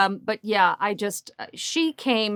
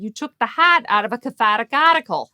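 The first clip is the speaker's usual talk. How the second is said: put-on louder, broad R-dropping Boston accent; 3 semitones higher, 6 dB louder than usual. Upset writer objects to an edit, Boston accent. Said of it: "this person had a Boston accent".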